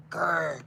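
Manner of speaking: mock growl at the computer; playful growl. Streamer growling at YouTube to make it behave, mock growl. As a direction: growl at the screen like a cartoon dog. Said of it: "I'm just gonna grr at it now".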